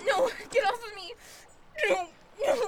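crying, begging no; tearful pleading. Acted. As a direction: sob out the pleas. Victim pleading during assault.

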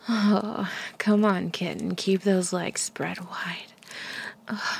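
normal talking voice

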